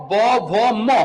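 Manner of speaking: ordinary talk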